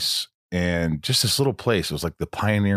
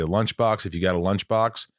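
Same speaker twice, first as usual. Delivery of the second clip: ordinary talk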